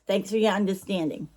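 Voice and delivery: ordinary talk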